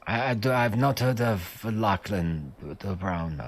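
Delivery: put-on slow, accented prisoner voice; foreign-accented guard, halting and unsure; hesitant captive guard. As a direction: stumble through a puzzled denial. Plays the captive guard answering.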